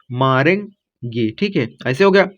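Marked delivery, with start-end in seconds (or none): 0.1-1.3 मारेंगे stretched very slowly, softer and lower; slow sounding-out. Shown as a demonstration. Teacher helping pupils read the word, slow sounding-out. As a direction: draw out मारेंगे piece by piece.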